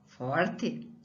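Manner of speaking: ordinary delivery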